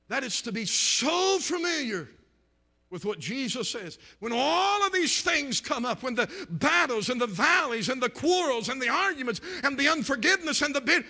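loud, high-pitched preaching surge; full-force preaching. Real, not acted. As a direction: pile up the list with revival-meeting force.

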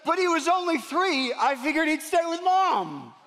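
lightly voiced father making excuses; flustered dad.

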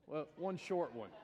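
ordinary talk, nothing marked

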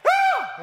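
a sudden, very high, loud "Ah!" of fright; startled scream. Retold or imitated. Teenager jumping round a corner, startled high scream. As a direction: yelp in shock as if caught off guard. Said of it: "like that".

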